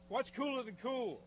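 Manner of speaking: high shout after soaking; in ice cold water. Real, not acted. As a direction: shout the punchline, still freezing. Joking after ice bucket.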